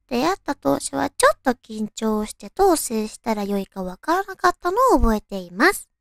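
ordinary talk

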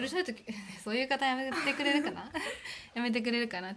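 laughing through the words; real laughter breaking in while protesting. Real, not acted. Scolding her teasing friend, laughing.

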